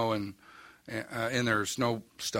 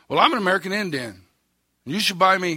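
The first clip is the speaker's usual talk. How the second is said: higher, louder and faster, a mock huffy protest; huffy indignation; 8 semitones higher, 8 dB louder than usual. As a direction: play the offended stranger demanding a sale, a bit hammed-up. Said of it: "huffy like this".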